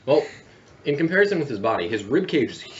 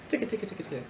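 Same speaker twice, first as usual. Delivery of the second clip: clattering bones sound; 9 semitones higher, 10 dB quieter, much faster than usual. Acted. GM voicing scattering bones.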